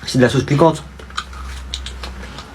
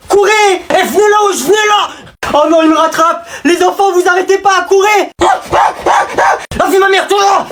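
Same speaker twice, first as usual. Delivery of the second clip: much higher shouted chase; 12 semitones higher than usual. Acted. Chasing the class, shouting high. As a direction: yell after them while running.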